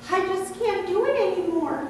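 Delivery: high, despairing little branch voice blurting it out; a worn-out vine branch pouring out its despair, pitched high; blurting grape branch. Acted. Tired grape branch blurts to the farmer, high and despairing. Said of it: "he blurted".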